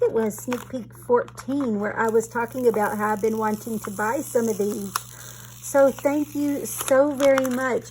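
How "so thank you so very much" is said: louder and higher, slow, delighted; thrilled thanks. Real, not acted. Vlogger thanks a friend for the surprise gift, delighted and loud. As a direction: gush with real delight.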